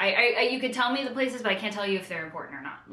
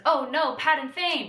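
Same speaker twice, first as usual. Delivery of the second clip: mock alarm; 7 semitones higher, 7 dB louder than usual. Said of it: "one of my notes says".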